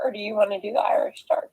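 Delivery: unvoiced fast whisper; a quick aside, whispered. Real, not acted. Asks partner in whisper.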